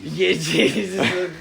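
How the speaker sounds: slow, laughing through the words. Real, not acted. Laughing at friend's remark.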